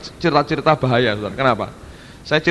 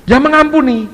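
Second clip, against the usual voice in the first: emphatic answer; 12 semitones higher, 8 dB louder than usual. Retold or imitated. Retold answer to the girl.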